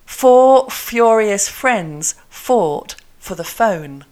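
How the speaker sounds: every F drawn out in "four furious friends"; very slow, separated "fought for the phone", strong F; slow F drill. Shown as a demonstration. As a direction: articulate "four furious friends" word by word, leaning on F. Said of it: "I'm gonna say f".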